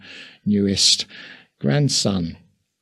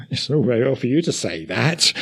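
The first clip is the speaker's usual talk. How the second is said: chuckling, slightly higher retort; amused, laughing; 3 semitones higher, faster than usual. Real, not acted. Preacher joking about the archbishop's casual remark, laughing. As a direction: protest through a light laugh.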